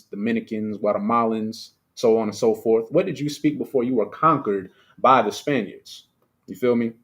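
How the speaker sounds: normal talking voice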